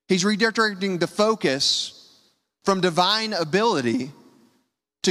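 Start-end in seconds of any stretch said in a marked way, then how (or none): none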